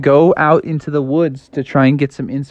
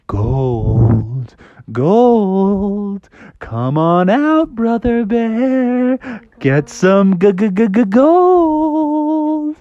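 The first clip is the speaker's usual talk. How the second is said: slow, ringing, stretched call; ringing echo; 7 semitones higher, much slower than usual. Acted. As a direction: let the word ring and echo. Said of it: "it started ringing like this".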